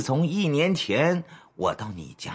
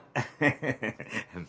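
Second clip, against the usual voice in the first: soft, low run of chuckles; 5 semitones lower, 9 dB quieter than usual. Acted. Boss brushing off the matron's question, low chuckle. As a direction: chuckle it off to cover up.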